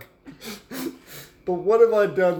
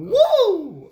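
cracking Ric Flair style woo, much higher; 7 dB louder than usual. Acted. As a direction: whoop it high until the voice cracks. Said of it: "my voice crack".

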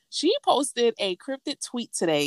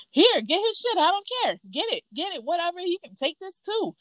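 high-pitched, agitated imitation; high mock tirade; fed-up mock ranting; 12 semitones higher than usual. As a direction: act out someone tossing things out in a huff. Acting out angry partner packing boxes.

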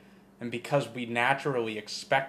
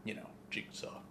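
very soft whisper for the spoiler; 18 dB quieter than usual. Real, not acted. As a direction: hush it so nobody overhears.

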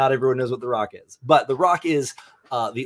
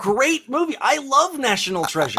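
excited praise; 12 semitones higher, 7 dB louder than usual. Real, not acted. Excited about favourite film.

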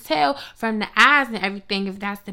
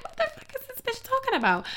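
much higher, softer incredulous aside; inner disbelief; 13 semitones higher, 5 dB quieter than usual. Retold or imitated. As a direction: think it, baffled, under your breath. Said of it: "I'm just like".

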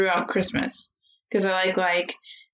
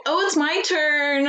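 high, slow sing-song delight; playful glee; 7 semitones higher, slower than usual. Real, not acted. Host claims her turn to pick, gleeful. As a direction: draw it out gleefully.

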